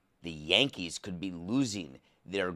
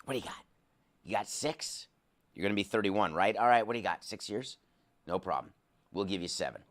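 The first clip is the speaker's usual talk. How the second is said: mock negotiation; 3 semitones lower, 6 dB quieter, much faster than usual. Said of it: "Call up the agent and say".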